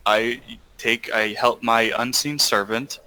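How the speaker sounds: ordinary talk, nothing marked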